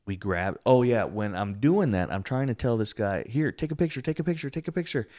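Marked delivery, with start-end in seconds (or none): 3.3-5.1 retold urgent plea. Urging friend during ghost encounter.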